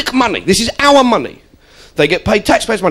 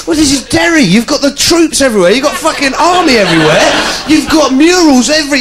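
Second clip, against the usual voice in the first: raised, mounting list of complaints; incredulous rant; 6 semitones higher than usual. Retold or imitated. Comic listing Derry's troops to the crowd, raised and incredulous. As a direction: pile the evidence up, half laughing. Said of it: "And I said to them".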